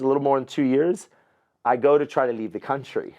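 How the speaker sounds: ordinary talk, nothing marked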